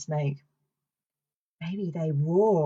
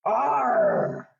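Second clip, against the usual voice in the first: higher, drawn-out pirate arr; pirate growl; 6 semitones higher than usual. Shown as a demonstration. Presenter showing children the pirate noise, playful growl. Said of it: "what noise a pirate makes".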